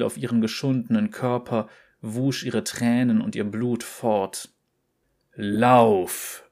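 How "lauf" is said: a louder, angrier command; the voice loses patience and pushes harder; angrier inner voice. A narrator's reading. Inner voice angrily drives the exhausted girl on. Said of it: "sagte die Stimme jetzt wütender".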